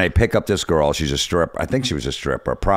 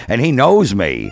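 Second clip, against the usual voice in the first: normal talking voice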